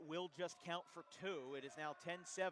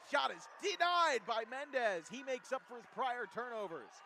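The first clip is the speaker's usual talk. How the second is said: loud, higher excited call; excited shout; 8 semitones higher, 10 dB louder than usual. Real, not acted. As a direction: shout the block. Big block called.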